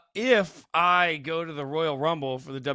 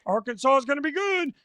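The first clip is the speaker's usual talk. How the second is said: higher, mock-optimistic fan voice; hopeful preseason voice; 8 semitones higher than usual. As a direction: sell the blind early-season hope. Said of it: "I don't know why that voice".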